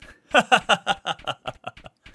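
high nervous laugh after a scare; relieved laugh. Real, not acted. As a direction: laugh off the fright.

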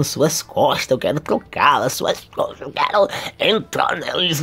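low, hushed, partly whispered; creepy mock longing. Kazuya staring at her back, hushed mock longing. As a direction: drop into a soft, low murmur.

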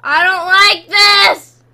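loud, drawn-out wail of protest; loud scared protest; frightened shout. Real, not acted. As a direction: yell it slowly, stretching the words. Scared outcry in horror game.

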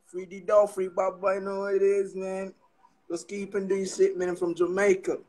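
ordinary delivery

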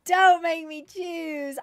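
anguished plea. Real, not acted. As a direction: drag it out as if torn between pets. Agonised over favourite pet.

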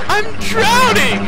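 high, slow cry of "drowning"; panicked shriek. Real, not acted. Gamer whose character is drowning, slow high panicked wail.

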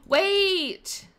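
high, loud, excited cry; excited exclamation. Real, not acted. Player spots a cute pink wall, excited exclamation. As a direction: call a sudden halt, high and loud.

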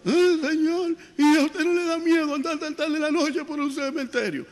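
voz muy aguda y temblorosa de susto; asustado. Acted. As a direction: pregunta chillando de miedo. (voz aguda y asustada)

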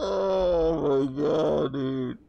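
slow, wheezing through laughter; gasping words between laughs; laughing. Real, not acted. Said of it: "I'm crying".

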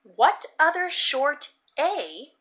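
ordinary delivery, nothing marked

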